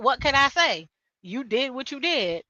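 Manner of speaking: ordinary talk, nothing marked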